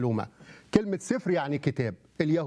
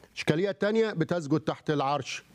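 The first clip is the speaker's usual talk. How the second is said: ordinary talk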